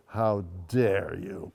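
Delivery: low, slow, cold "how dare you"; indignant retort. Retold or imitated. Cora silencing the nosy woman on the train, slow and cold. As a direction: shut her down, slow and icy.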